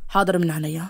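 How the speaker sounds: low-voiced, teasing obedience; sarcastic. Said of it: "حاسة إنك بتتريق". (بسخرية خفيفة)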